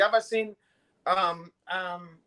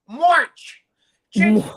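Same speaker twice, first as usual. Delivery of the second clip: shouted, much higher and louder, barked order; mock shout; 4 semitones higher, 11 dB louder, much slower than usual. Imitating a mob boss ordering abort, mock shout. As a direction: yell it like a furious boss.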